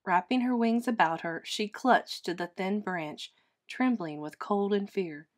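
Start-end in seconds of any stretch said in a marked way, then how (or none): none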